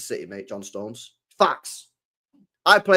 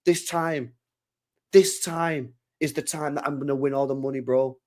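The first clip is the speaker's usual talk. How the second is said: imitating gambler mate. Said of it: "he's like".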